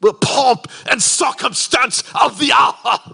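put-on high, loud, pompous British accent. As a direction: declaim it like a mock British grandee. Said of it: "default to the British accent".

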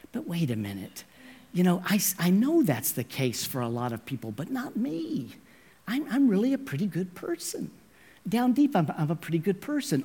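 a hint of smug self-praise, soft and quick; smug self-talk. Listener imagining himself good, mock smug murmur. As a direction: pat yourself on the back quietly. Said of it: "we think".

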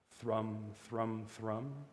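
low, hushed thrumming imitation; strumming strings. A narrator's reading. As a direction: murmur it like softly plucked strings. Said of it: "went the strings".

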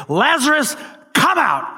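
high, commanding shout; loud shout. Acted. Jesus calling Lazarus out of the tomb, shouting. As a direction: call out forcefully, pitch leaping up. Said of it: "he shouts in a loud voice".

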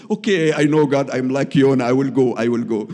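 a loud vow to God, I will go; loud resolve. Retold or imitated. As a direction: declare it with full conviction. Answering God's call, resolved.

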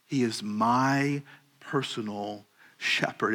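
"my" leaned on, whole line slowed; unhurried weight on "my"; stress on my. Shown as a demonstration. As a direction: press "my" in "He is my personal shepherd". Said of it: "not just the shepherd or a shepherd".

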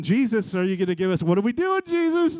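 high, loud panicky plea; hammed-up frantic cry for help; frantic pleading. Acting frantic disciples in the storm.

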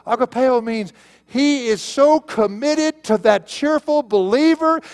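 high, loud, impassioned pulpit proclamation; fervent preacher at full cry, voice pitched high; full-force preaching. Real, not acted. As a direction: hammer each phrase home to the congregation.

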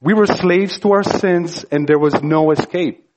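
ordinary talk, nothing marked

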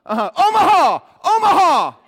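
shouting the audible, high and loud; quarterback calling audible. Retold or imitated. Quarterback shouting the audible to his line, loud call. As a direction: bark out the call over the crowd noise. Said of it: "and go".

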